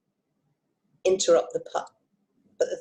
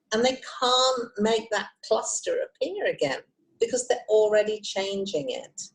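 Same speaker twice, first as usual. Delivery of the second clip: normal talking voice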